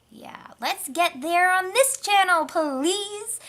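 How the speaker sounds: high, loud eager plea; pleading. Real, not acted. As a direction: beg the viewers cheerfully.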